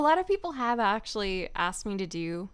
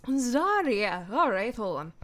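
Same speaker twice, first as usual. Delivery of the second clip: Zarya voice; 3 semitones higher, 4 dB louder, slower than usual. Acted. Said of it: "For Zarya".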